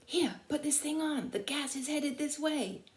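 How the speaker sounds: high, urgent yapping plea; urgent dog voice. Acted. The dog Stubby urges sleeping Conroy awake, high and urgent. Dog urging gas mask.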